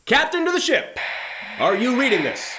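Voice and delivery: urgent loud hail to the ship; calling out. Acted. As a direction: shout for an answer that isn't coming. No reply, captain calls again.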